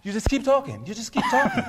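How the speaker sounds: put-on high-pitched joke British accent; mock British accent. Comedian jokes how to get an accent, high mock British. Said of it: "How do you get a British accent?".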